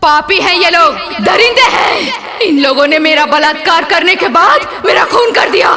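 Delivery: loud, impassioned outcry; anguished accusation. Acted. Murdered woman denouncing her killers to inspector, anguished shout.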